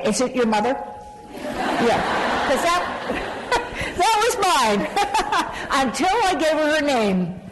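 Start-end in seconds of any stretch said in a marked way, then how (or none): 2.5-7.4 high, giggling admission; laughing. Real, not acted. Speaker laughs admitting her critic was her mother. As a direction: laugh through the confession.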